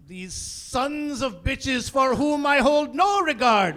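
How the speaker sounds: slow, loud, grandly formal curse; English-teacher dad swearing. Acted. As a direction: declaim the insult in measured indignation. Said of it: "his swearing was like".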